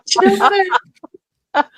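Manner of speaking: high, laughing with delight. Real, not acted.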